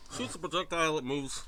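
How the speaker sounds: talking through chocolate; words around a mouthful; mouth full. Real, not acted. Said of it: "with my mouth full of chocolate".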